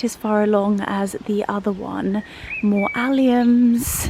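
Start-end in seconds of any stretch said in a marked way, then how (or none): none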